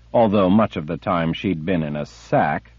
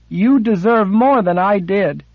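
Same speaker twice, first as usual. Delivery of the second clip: put-on high, loud accusing girl's voice; young girl's angry accusation; 13 semitones higher, 6 dB louder than usual. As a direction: hurl it at him, high and bitter. Girl accuses her captor.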